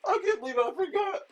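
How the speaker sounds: high-pitched, squealed realization; amused disbelief. Real, not acted. Co-host realizing he forgot Santa Claus, real high-pitched amused disbelief. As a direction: squeak it out, tickled by your own lapse.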